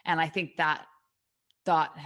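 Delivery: ordinary delivery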